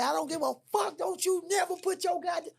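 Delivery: very high, shrieking curse; mother screaming. Retold or imitated. Girl's mother screaming at him before slapping, very high.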